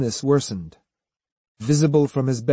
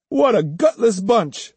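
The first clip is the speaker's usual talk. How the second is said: higher, jeering scorn; contemptuous follower; 8 semitones higher than usual. Acted. Follower jeering at the students who won't fight, contemptuous. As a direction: jeer at them as cowards, pitched up. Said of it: "said Judo".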